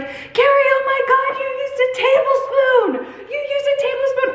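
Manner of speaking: very high, mock-panicked squeal; alarmed commenters. Viewers alert creator to her spoon mistake, mock hysteria. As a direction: imitate frantic commenters, far above your own pitch. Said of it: "people being like".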